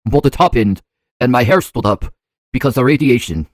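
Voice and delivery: best attempt at a Russian accent. Shown as a demonstration. Reacting to the Chernobyl video, in a put-on Russian accent. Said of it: "that's my best, uh, Russian accent".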